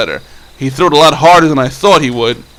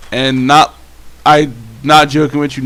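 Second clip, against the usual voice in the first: ordinary delivery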